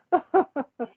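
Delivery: high burst of giggles; real laugh. Real, not acted. Cracking up at the idea of Richard singing, laughing. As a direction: laugh helplessly, high-pitched.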